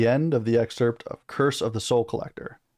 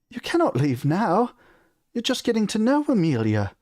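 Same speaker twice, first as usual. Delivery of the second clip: fretting mother; 10 semitones higher than usual. A narrator's reading. Mother begs her son not to leave, fretting high. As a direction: plead in a much higher motherly voice. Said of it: "his mother fretted".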